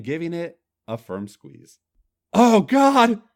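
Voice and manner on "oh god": high, loud groan of pleasure. Acted. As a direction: let out a big groan. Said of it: "groaned Skip".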